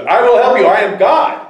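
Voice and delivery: high, loud, declaiming as God; God's voice. Acted. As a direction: boom it out as a rescuer from above. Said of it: "And a voice comes".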